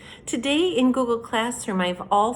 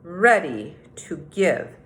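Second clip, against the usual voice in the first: storybook read-aloud, unhurried and clear; reading to children. A narrator's reading. Teacher reading The Tortoise and the Hare to third graders, storytelling. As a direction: read it like story time on the rug.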